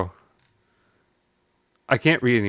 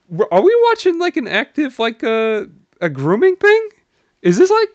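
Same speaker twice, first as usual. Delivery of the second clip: high, loud, stunned question; can't believe what he's reading; shocked disbelief; 13 semitones higher, 4 dB louder than usual. Real, not acted. Shocked by a grooming post.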